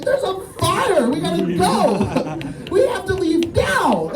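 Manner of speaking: high-pitched, drawn-out panicked cry; hammed-up alarm, stretching the words to warn them; freaking out. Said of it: "You gotta stop freaking out".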